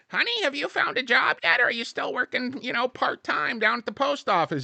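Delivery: high, sweet mock-mother voice; mom voice. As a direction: put on a high fussy mom voice. Son voicing his mother.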